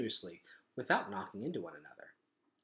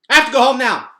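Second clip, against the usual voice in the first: high, loud urgent blurt; 11 semitones higher, 15 dB louder, faster than usual. Acted. Gregor blurting to the Underlanders that he must leave. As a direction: burst it out all at once. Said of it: "Gregor blurted out".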